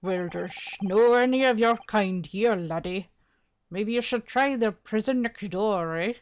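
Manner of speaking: lively brogue for a dismissive governor; attempted Irish accent. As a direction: brush them off in an Irish brogue. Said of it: "doing this man's voice is gonna be a struggle".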